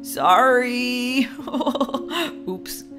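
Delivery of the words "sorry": low, drawn-out apology; sheepish. Acted. Husband caught on his wife's stretcher, sheepish. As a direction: mumble it guiltily.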